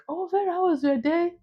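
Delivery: high, sweet caring landlady. Retold or imitated. Imitating her landlady greeting her after work. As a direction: coo it like a kind landlady. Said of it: "she's like".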